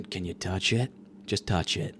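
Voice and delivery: low, soft pleading; mock coy request. Patient asking hot doctor, mock-coy low murmur. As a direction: drop to a hushed, low come-on.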